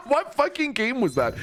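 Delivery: laughing through the question. Real, not acted. Streamer laughs at an old photo, laughing while asking. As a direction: ask it while cracking up.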